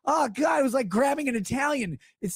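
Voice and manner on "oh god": high squealed ew of disgust. Retold or imitated. Grabbing a buttery dish, a high disgusted squeal. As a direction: recoil at the greasy butter. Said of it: "you grab it and you're like".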